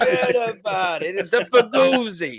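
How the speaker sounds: high Italian New York wiseguy voice, mock tough; Italian New York accent. Hammed-up Italian New York accent for the laugh from the lads. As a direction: play the Brooklyn Italian, forget about it. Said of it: "I'm an Italian New York".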